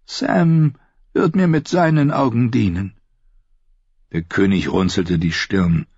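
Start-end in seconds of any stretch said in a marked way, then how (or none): 0.1-2.9 put-on high-pitched old man; courteous old maester. Blind maester explains to the king, high and courteous. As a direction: answer gently in an elderly voice.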